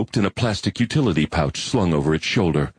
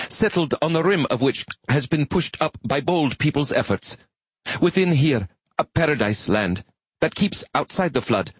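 high, earnest alien reading aloud; earnest arachnid recital; 9 semitones higher than usual. Acted. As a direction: recite solemnly as a proud spider creature.

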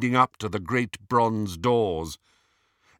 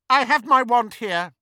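high, loud, cutting interruption; cold; 14 semitones higher, 9 dB louder than usual. A narrator's reading. Disguised Hermione silencing a doubting Travers, coldly. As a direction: cut him off coldly in a high voice. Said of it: "said Hermione coldly".

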